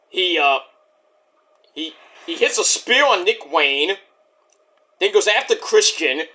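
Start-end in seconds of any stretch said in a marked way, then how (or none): none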